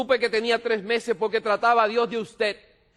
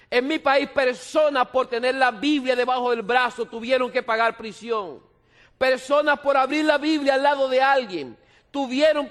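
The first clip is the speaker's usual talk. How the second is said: raised, urgent preaching voice, slowing to plead; louder, higher pastor's appeal; fervent preaching; 4 semitones higher, 3 dB louder than usual. Acted. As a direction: press the flock to read the Bible. Preaching at full force.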